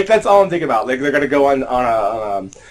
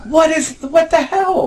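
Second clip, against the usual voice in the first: shocked disbelief; 4 dB louder, slower than usual. Real, not acted. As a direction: voice jumps up high in sudden disbelief. Host shocked by plot hole.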